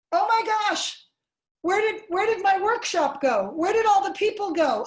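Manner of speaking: high, loud mock bewilderment; mock astonishment. Shown as a demonstration. Lecturer playing peekaboo to show object permanence, mock astonishment. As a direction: play up shock that everyone has vanished. Acting out peekaboo, people vanished.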